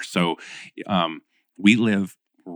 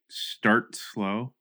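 drawn-out, softer slow delivery; slow start; 5 dB quieter than usual. Shown as a demonstration. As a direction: stretch "start slow" out at half speed. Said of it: "is the speed".

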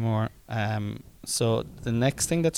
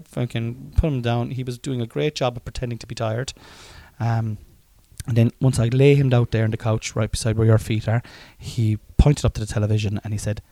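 ordinary talk, nothing marked

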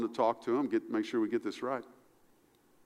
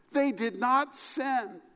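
normal talking voice

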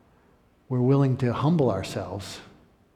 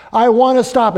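loud, high breaking plea; a desperate outburst at the meeting; desperate cry; 10 semitones higher, 10 dB louder than usual. Retold or imitated. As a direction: cry it out, begging for help.

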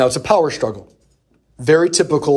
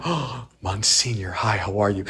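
meek reverent greeting; 5 semitones lower, 8 dB quieter than usual. Retold or imitated. Guys greeting the exorcist, meek and hushed. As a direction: tiptoe up to the great man nervously.

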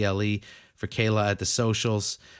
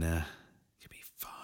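whispered reassurance; 5 semitones lower, 10 dB quieter, much slower than usual. Acted.

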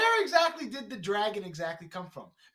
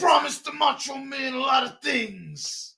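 slow, loud and higher mock last words; overacted death scene; 8 semitones higher, 6 dB louder, slower than usual. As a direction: shout out hammy dying words. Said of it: "he just dies dramatically".

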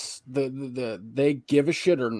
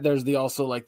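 ordinary talk

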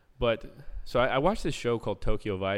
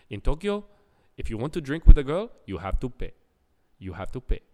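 put-on Jamaican accent; 4 semitones higher, 9 dB louder than usual. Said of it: "He's like".

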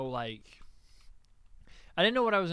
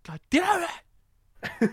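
much higher, louder outburst; mock exasperated yell; 9 dB louder, slower than usual. Retelling being outsmarted by a friend, exasperated mock yell. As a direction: curse loudly in hammed-up frustration.

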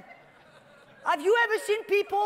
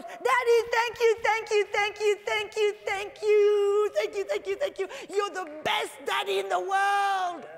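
put-on overjoyed child; 4 semitones higher than usual. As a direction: gush like a boy who just got his wish. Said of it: "he gets so excited".

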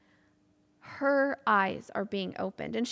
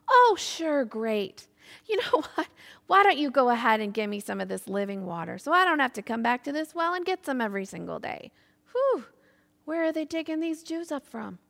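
loud, quickening mock enthusiasm; sarcastic; 2 semitones higher, 5 dB louder than usual. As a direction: scoff with exaggerated swoops in pitch. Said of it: "she sticks with her sarcastic tone".

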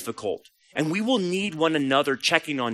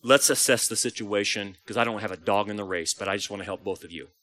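low, soft, detached outsider; calm, hushed, impartial mediator; without emotion; 9 semitones lower, 8 dB quieter than usual. Acted. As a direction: say it quietly and objectively, dropping the energy. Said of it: "come in objectively without emotion".

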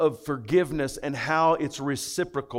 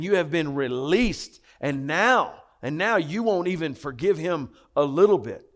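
usual speaking voice

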